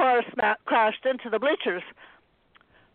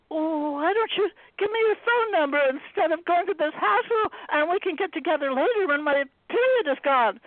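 high, anxious sweet talk; nervous coaxing; 7 semitones higher than usual. Acted. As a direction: stall him with a sweet tone. Anna bargaining nervously.

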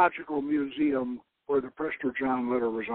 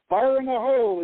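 playful warning call; 7 semitones higher, 5 dB louder than usual. A narrator's reading. Popping the champagne cork.

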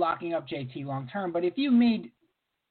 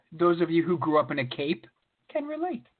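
usual speaking voice

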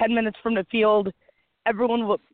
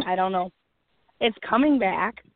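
ordinary talk

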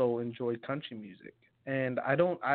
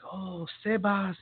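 imitated South American mother's accent, higher and drawn out; mom's accent; 7 semitones higher, slower than usual. Son imitating his mom lecturing him, in her accent. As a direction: do your immigrant mom's accent, stretching the name. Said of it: "this is my mom's accent".

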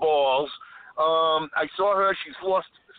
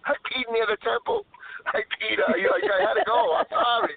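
pitched up, guilty and pleading; defensive pleading; 7 semitones higher than usual. Real, not acted. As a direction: plead your innocence, laughing it off. Defends peeing near temple.

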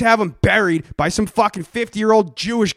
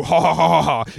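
mock evil ha-ha-ha as a scheming boss; evil villain laugh; 4 semitones lower than usual. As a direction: cackle like a cartoon villain boss. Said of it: "I see Vince McMahon saying".

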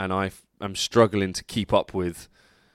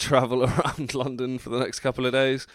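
normal talking voice

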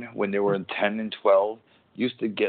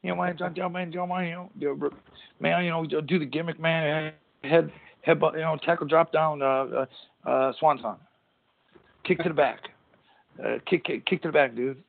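a rambling pro wrestler talking moves, pitched up; Jeff Hardy impression; 7 semitones higher than usual. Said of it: "yeah, that's Jeff Hardy".

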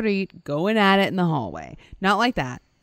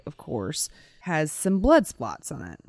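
ordinary talk, nothing marked

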